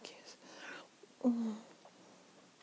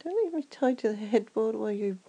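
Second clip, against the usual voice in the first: usual speaking voice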